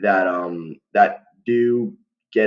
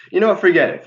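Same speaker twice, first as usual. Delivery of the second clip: much higher and louder, fed up; exasperated outburst; 10 semitones higher, 7 dB louder, faster than usual. Real, not acted. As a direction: snap out "Forget it." in exasperation, loud. Gives up on awkward explanation.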